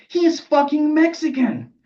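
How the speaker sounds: high, loud and drawn-out protest; outraged outburst. Acted. Decanonizer exposing the narrator's slight, outraged. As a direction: spell out your outrage slowly, way up high.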